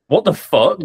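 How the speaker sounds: loud, high disbelieving exclamation; retold shock. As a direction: blurt it out like you can't believe your eyes. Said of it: "we went".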